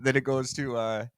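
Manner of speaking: usual speaking voice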